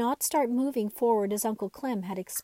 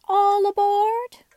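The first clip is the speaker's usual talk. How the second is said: much higher and louder, a slow drawn-out call; cried out; 10 semitones higher, 9 dB louder, much slower than usual. A narrator's reading. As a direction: call everyone aboard like a train conductor. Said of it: "Raggedy Andy cried".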